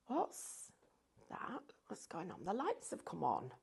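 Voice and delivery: soft, whispered inner voice; hushed, puzzled wondering to yourself; whispered thoughts. Retold or imitated. Retelling her own puzzled thoughts.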